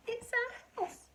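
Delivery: high squeaky mother mouse; mother mouse squeaking her trouble to George. Acted. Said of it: "squeaked the mo".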